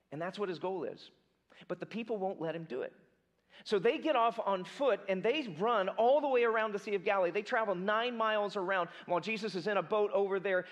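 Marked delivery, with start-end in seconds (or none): none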